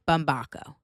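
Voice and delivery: Bumbaco as bum-BAH-ko; outsiders' pronunciation. Shown as a demonstration. Podcaster tells listeners how they would say it, bum-BAH-ko. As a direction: open the A in Bumbaco to AH. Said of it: "you would say".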